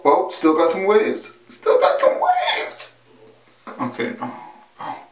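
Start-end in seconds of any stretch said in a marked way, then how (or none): 1.5-3.0 drawn-out, squeaky crow of delight; gleeful boast. Real, not acted. Man admiring his hair waves, gleefully boasting. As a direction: stretch it out slowly into a giddy high squeal.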